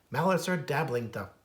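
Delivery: ordinary delivery, nothing marked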